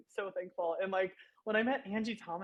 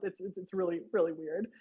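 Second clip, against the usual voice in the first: ordinary talk, nothing marked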